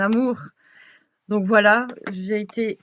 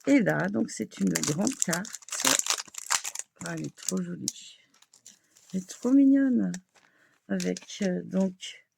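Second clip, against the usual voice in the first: usual speaking voice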